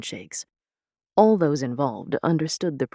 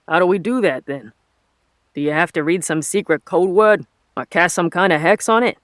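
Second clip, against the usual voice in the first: raised, louder Boston foreman; puzzled questions in broad Boston; thick Boston accent; 3 semitones higher, 7 dB louder than usual. A narrator's reading. Troubled foreman asks how to break the glyphs, thick Boston accent. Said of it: "in a thick Boston accent".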